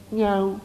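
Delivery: gently imitating a cat's meow; mock cat meow. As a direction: meow back the way the cat answers him. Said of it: "κάνει".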